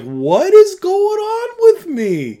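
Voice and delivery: very high, drawn-out, loud squeal; imitating busting up. Youth leader retelling how he couldn't stop laughing, high helpless squeal. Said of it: "I just started busting up".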